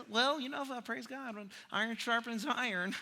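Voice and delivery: quiet mock-pious voice; mock churchy talk. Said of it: "we talk like this".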